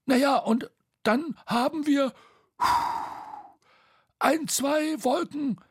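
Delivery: high voice with long pauses; hesitant excuse. A narrator's reading.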